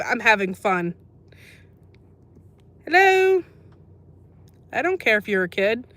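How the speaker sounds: normal talking voice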